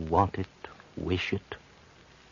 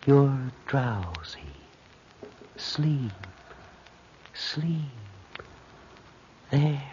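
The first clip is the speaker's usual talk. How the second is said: hypnotic lulling; slower than usual. Acted. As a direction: lull her under like a mesmerist. Hypnotist deepens the trance.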